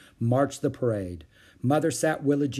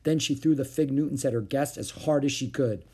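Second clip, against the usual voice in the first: usual speaking voice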